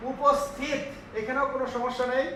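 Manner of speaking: usual speaking voice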